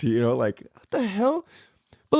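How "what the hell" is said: retold shock. Kid just hurt on the monkey bars, shocked. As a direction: mimic stunned disbelief up high.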